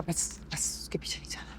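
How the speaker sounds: quick, hushed whisper; urgent whispered aside; whispered warning. Acted. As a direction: whisper it fast under your breath. Hushed plea not to follow.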